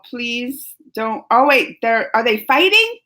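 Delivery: ordinary talk, nothing marked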